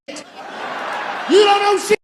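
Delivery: You don't know shit, shouted high, slow and loud. Shown as a demonstration. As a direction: stretch and yell it out. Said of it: "said twice, second shouted".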